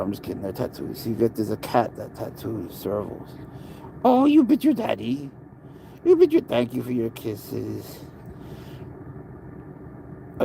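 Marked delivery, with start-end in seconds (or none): 4.0-6.4 much higher, playful mock scold. Real, not acted. Owner teases cat that bit him, high pitched.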